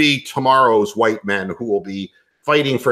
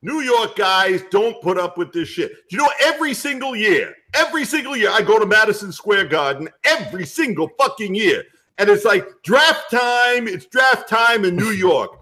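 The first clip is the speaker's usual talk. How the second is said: put-on higher-pitched, fed-up New York accent; New York guy; 10 semitones higher than usual. New York fan griping about draft time, aggrieved New York accent. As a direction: launch a New York fan's grievance.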